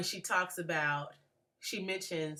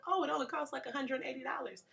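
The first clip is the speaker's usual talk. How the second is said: imitating a breezy classmate in a much higher voice; cavalier classmate; 9 semitones higher, faster than usual. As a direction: toss off the price lightly, pitched way up. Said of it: "she so cavalierly said".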